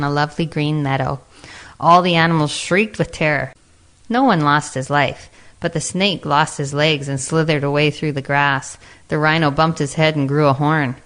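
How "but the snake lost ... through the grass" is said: ordinary delivery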